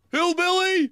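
high-pitched mock yell; imitated heckler's yell. Heckler yelling at the bingo host, imitated shout. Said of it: "one of them yells out".

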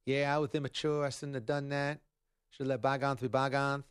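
lightly voiced, subdued apologetic quote; a hint of a contrite boxer, a little soft; Mike Tyson. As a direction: mildly imitate a muted apology. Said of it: "Mike is apologizing".

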